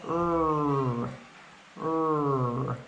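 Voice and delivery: slow growled "R" with tongue pulled back; r sound. Shown as a demonstration. Teacher showing the R sound to learners. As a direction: draw out a long "R". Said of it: "the R sound says".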